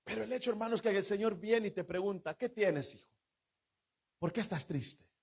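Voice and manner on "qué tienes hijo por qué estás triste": put-on soft, gentle voice of the Lord asking a sad child; the Lord gently asking a sad son what is wrong; tender question. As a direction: hush down and ask kindly.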